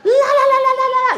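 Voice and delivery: very high, squealing cartoon war cry "Cobra!" then a chanted la la la; shrill cartoon chant, hyped and laughing; Cobra battle cry. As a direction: squeal it like the cartoon villains charging in. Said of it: "and I used to hear".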